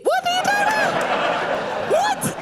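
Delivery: squealed, high and slow, mock outraged; a hammed-up squeal of indignation; mock indignant squeal. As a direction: play up the disbelief, high and slow.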